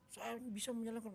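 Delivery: imitating a child's firm affirmation; confident. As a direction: say it like a child psyching himself up. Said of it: "kencang, meyakinkan, dan penuh percaya diri".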